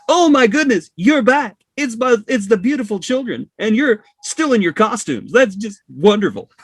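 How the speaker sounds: put-on gushing high voice for cute kids; delighted grandfatherly gush. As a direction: coo at the kids like a thrilled granddad. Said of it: "he's like granddad".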